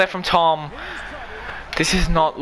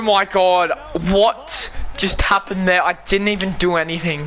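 ordinary delivery

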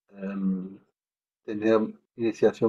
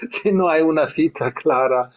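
laughing; 4 semitones higher than usual. Real, not acted. Laughs while making his point.

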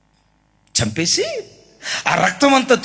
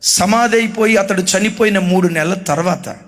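ordinary talk, nothing marked

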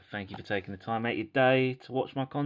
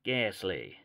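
amateur American cowboy impersonation; dodgy American accent; 6 dB louder, slower than usual. Pretend. Collector revealing the rare to viewers, dodgy American accent. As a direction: keep up the mock American accent card by card. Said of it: "That's my dodgy American accent impersonation".

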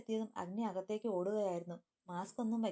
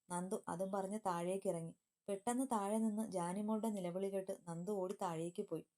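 ordinary delivery

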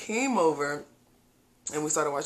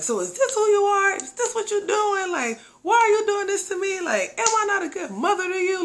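much higher, pleading mock-mom voice; mom going off; 4 dB louder than usual. Mom confronting her daughter after catching her, high pleading mock rant. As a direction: play the wounded mother, overdone. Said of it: "she just started going off".

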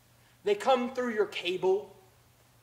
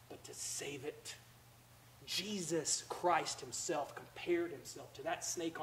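quiet reflective preaching; 7 semitones lower, 15 dB quieter than usual. Acted. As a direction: draw them in with a near-whisper. Quiet reflective sermon passage.

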